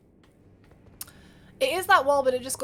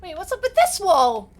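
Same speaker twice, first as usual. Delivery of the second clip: startled, loud question; sudden suspicious outburst; surprised; 6 semitones higher, 10 dB louder than usual. Real, not acted. Surprised at wall.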